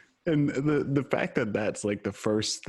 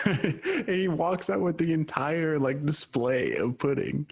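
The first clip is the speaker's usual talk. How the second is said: higher, slow, laughing through words; 5 semitones higher, slower than usual. Real, not acted. Friend finishing the pudding story, laughing. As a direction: giggle while finishing the story.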